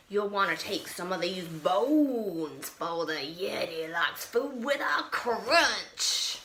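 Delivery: lightly voiced monster. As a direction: offer advice as a friendly beast. Betty's voice giving advice.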